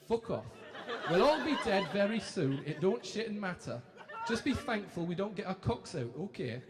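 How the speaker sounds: lightly put-on Geordie TV presenters; chirpy Geordie telly hosts; a hint of Ant and Dec's Geordie patter; Geordie accent. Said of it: "Apologies for the accent now".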